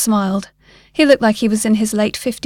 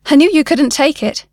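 raised voice, higher and louder; shouted; 5 semitones higher, 6 dB louder than usual. A narrator's reading. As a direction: shout it after someone walking out. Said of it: "the man shouted at his back".

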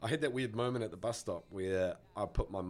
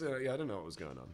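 ordinary talk

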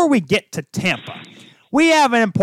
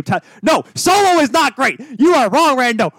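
higher, louder rebuttal shout; yelling; 6 semitones higher, 4 dB louder than usual. Real, not acted. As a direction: shout him down, call him wrong. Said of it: "Alex, you are getting yelled at".

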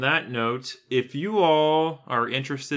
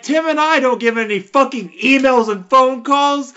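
high, loud furious rant; angry yelling; 11 semitones higher, 8 dB louder than usual. Real, not acted. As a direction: yell it, fed up and indignant. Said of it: "I know I'm yelling and I'm angry".

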